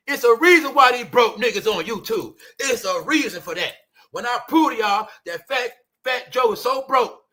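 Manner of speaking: ordinary talk, nothing marked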